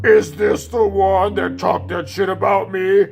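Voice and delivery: slow, loud, drawn-out bully voice; menacing bully. Retold or imitated. Bully confronting the one who talked about her, slow and menacing. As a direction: stretch every word as a challenge.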